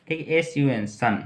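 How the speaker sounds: usual speaking voice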